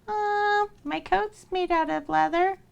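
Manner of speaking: put-on high, hesitant child voice; sheepish little girl. Little girl explaining her coat to Baby Wolf, sheepish. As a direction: squeak out an awkward admission. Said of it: "And she said".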